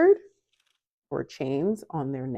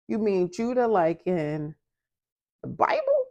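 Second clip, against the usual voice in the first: rising, incredulous question, much higher at the end; retold disbelief; 5 semitones higher than usual. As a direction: ask it like you can't believe it. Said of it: "And I said".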